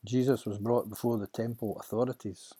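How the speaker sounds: plain reading voice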